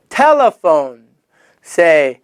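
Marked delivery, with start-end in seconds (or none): none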